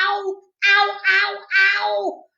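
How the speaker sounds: high, repeated yelps; hollering in pain. A narrator's reading. Child who banged their head, hollering. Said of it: "I hollered".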